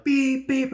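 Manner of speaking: high mimicked fire-alarm beeps; imitated alarm beeps. Alarm heard through the whole building, imitated beeping. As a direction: beep like an alarm. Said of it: "it's really loud".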